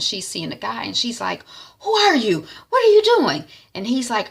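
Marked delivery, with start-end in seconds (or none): 1.8-3.4 high, loud, alarmed questions; startled. Retold or imitated. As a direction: wake up shocked and shout. Startled woman waking.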